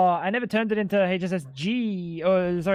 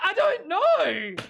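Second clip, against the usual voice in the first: mock squeaky voice; 11 semitones higher, much slower than usual. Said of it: "Why he sound like that?".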